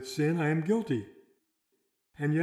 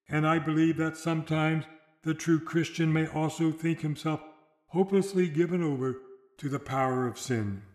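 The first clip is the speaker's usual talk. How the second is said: usual speaking voice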